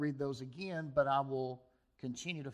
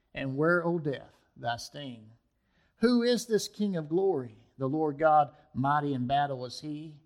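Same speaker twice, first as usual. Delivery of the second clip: dramatic sermon poem reading, building to loud peaks; preacher reading a poem about Christ to his church; poem recitation; 5 dB louder than usual. Acted. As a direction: recite the poem to the congregation with rising fervor. Reads an anonymous poem aloud.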